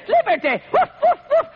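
high yapping little-dog voice with a laugh; mock the preachers as yapping lapdogs; mocking Chihuahua. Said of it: "I'm tired of hearing folks say".

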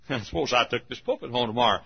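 ordinary talk

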